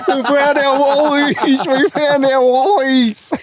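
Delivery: very high, drawn-out gleeful cheer; jubilant celebration. Retold or imitated. Two mates dancing in the street over found wallets, jubilant. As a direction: squeal it slowly like a victory chant. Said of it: "dancing around the street like".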